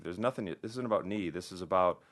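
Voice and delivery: ordinary delivery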